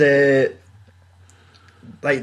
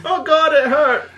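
high, loud-ish mock yelp; overacted pain reaction; 14 semitones higher, 4 dB louder than usual. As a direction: play hurt far too hard, laughing. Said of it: "Somebody's trying too hard to be".

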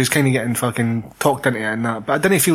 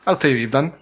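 ordinary delivery

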